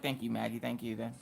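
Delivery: soft, low under-the-breath thanks; muttered thanks. Retold or imitated. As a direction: whisper thanks so nobody hears. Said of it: "I was like".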